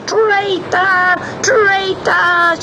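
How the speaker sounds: very high, drawn-out witch screech; shriek of rage. A narrator's reading. Sea witch swept away by the wind, enraged shriek. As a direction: screech each word high and long. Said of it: "With a shriek of rage".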